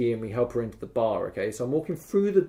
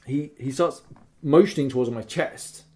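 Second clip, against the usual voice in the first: ordinary talk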